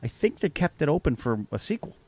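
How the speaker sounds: dad's mild remark. Retold or imitated. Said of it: "he called me and goes".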